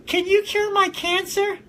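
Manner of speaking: put-on high, slow little-boy voice; sick kid's plea. Billy the sick kid asks the woman, high slow child voice. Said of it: "And he's like".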